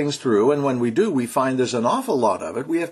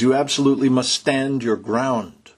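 normal talking voice

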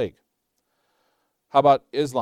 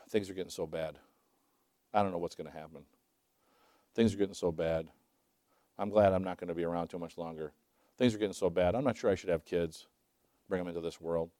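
lower, softer, fretful gloom; preacher mimicking a doom-saying believer, lower and softer; mock worried believer; 8 semitones lower, 9 dB quieter than usual. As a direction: imitate a gloomy believer moaning about the world. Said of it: "Have you heard believers talk like that?".

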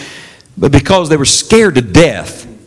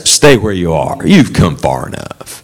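voice of self; 11 semitones lower than usual. Acted. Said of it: "Self says".

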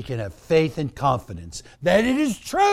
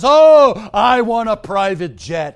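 hammed-up demanding, with loud bursts; mock greedy asking; 3 semitones higher, 5 dB louder than usual. Health and wealth believers asking Jesus, mock greedy demands. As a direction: whine for riches in mock entreaty. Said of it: "so many today".

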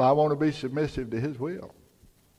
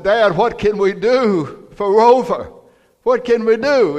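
put-on pleading little boy; 8 semitones higher, 7 dB louder than usual. Little boy begs Dad to help his dog, pleading. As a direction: beg like a distressed small boy. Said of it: "his little boy said".

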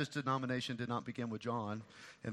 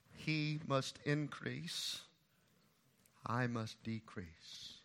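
very slow, soft motto; quiet solemn recitation; slow solemn quote; 6 dB quieter, much slower than usual. Retold or imitated. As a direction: weigh each word. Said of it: "makes this statement".

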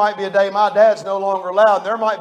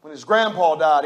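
ordinary delivery

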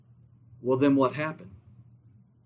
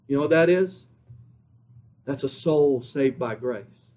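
ordinary talk, nothing marked

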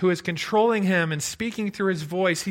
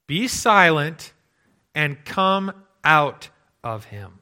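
slow, loud command to a demon; Jesus commanding the demon, slow and loud; commanding rebuke; 7 dB louder, slower than usual. Retold or imitated. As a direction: order the spirit out, slow and firm.

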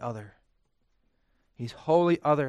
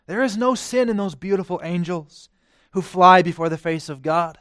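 ordinary delivery

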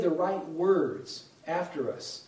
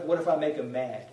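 ordinary talk, nothing marked

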